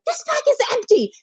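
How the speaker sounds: high, exasperated retold outburst; frustrated outburst. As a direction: snap it out in disbelief. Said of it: "and I'm like".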